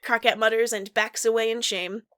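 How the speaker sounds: ordinary talk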